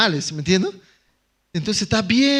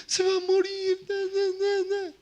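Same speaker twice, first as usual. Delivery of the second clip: high, wailing mock lament of a crying pastor; imitating crying pastor; 12 semitones higher, 4 dB quieter than usual. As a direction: squeeze it out like a man in tears, much higher. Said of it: "El pastor estaba llorando también". (imitando a un pastor que llora, agudo y quejumbroso)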